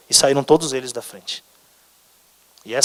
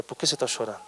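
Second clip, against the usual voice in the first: tender and quiet; gentle questioning; 2 semitones lower, 8 dB quieter, faster than usual. Acted. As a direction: press gently, almost in a hush. God keeps gently asking.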